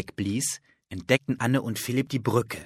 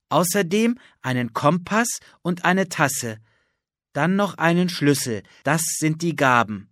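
louder, measured recital of a rhyme; boy reciting a magic rhyme from his notebook, louder; reading a rhyme aloud; 4 semitones higher, 5 dB louder than usual. Acted. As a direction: read the verse out like a riddle. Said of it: "las laut".

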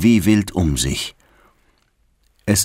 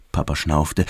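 ordinary delivery

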